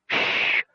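unvoiced "shh" hush; soft hissed shush; sharp shushing. A narrator's reading. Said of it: "seven gnomes said at once".

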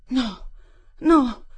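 rising into a high, loud desperate no; wild cry for help. Acted. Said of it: "in una sorta di selvaggia invocazione d'aiuto".

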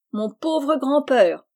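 slow, loud, bitterly mocking cry; bitter exclamation. A narrator's reading. Laurie scornfully echoes Jo's pity for his grandfather, bitter. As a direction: drag out the words with scorn. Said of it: "s'écria Laurie".

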